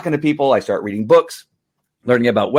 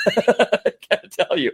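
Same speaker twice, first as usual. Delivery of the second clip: ordinary talk, nothing marked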